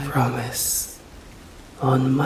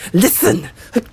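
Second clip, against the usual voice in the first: louder, urgent snap; sharp command; 5 semitones higher, 5 dB louder, faster than usual. Acted. Vampire snapping at the startled human, loud. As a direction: cut through their panic with one word.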